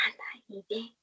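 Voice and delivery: whispered nudge; hushed push forward; whispering go on; whispered urging. Retold or imitated. Brother urging her in church.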